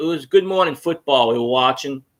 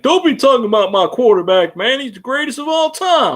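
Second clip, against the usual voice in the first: mock Panthers fan; 6 semitones higher, 4 dB louder than usual.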